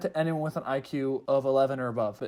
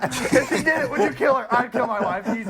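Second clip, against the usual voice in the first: laughing tease; 8 semitones higher, 4 dB louder than usual. Real, not acted. Teasing friend with laughter.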